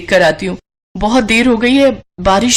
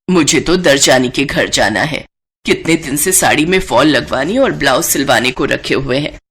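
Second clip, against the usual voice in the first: put-on a lower, older mother-in-law voice; 7 semitones lower than usual. Mother-in-law offers her daughter-in-law help with laundry, lower older voice.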